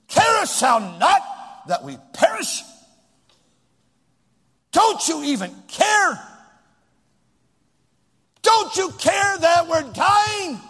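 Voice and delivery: loud, high, slow accusing shout; irreverent accusation. Retold or imitated. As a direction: rail at the sleeping master. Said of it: "Watch what they say, the irresponsible, irreverent".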